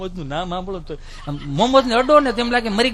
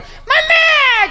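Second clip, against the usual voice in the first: much higher, long drawn crying call; child wailing; 6 dB louder, much slower than usual. Retold or imitated. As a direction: wail for mom like a hurt kid. Fallen child cries out. (રડતું બાળક મમ્મીને બૂમ પાડે)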